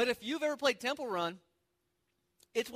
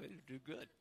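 mumbled through chewing, much softer; chewing while talking; mouth full; 6 semitones lower, 16 dB quieter than usual. Real, not acted. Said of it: "I can't even talk".

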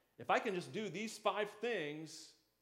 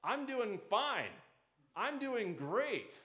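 slow, higher, overly bright brush-off; mock cheerful; 6 semitones higher, 4 dB louder, slower than usual. Church people pretending all is well, slow mock cheer. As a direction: fake a fine-and-dandy smile. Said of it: "they all say".

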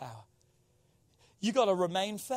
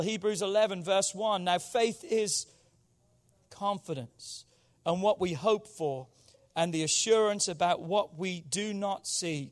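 ordinary talk

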